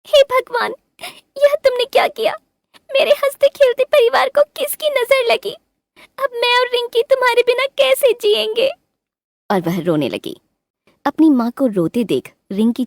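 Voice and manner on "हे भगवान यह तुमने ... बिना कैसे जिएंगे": high, wailing lament as she weeps; high tearful bird voice calling on God; crying mother bird. A narrator's reading. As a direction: cry out to God in grief. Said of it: "वह रोते-रोते बोली".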